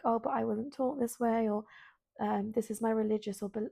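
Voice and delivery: ordinary talk, nothing marked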